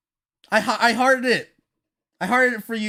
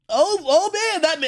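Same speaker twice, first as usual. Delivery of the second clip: ordinary talk, nothing marked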